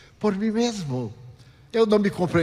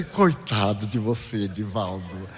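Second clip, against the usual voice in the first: self-pity; 6 semitones lower, 3 dB quieter than usual. Retold or imitated. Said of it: "com pena de mim".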